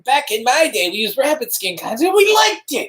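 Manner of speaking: put-on high, cranky old-timer griping; an old man boasting about the old days. Old-timer back in my day.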